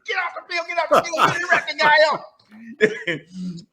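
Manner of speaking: high, far mock coach yell; imitated coach hollering from the sideline; coach screaming. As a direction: yell him off the field like an angry coach. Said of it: "Coach is screaming at me".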